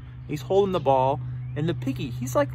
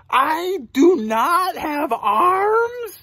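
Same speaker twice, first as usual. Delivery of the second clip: slow, higher-pitched astonished snake question; real surprised; 7 semitones higher, 4 dB louder, much slower than usual. Acted. Snake learning he has no arms, real surprised. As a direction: read it slowly, voice lifting in surprise. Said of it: "he's real surprised".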